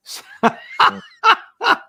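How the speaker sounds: high, loud amused laugh; real hearty laugh; burst of laughter. Real, not acted. Laughs at the viewer's Scottish brogue request.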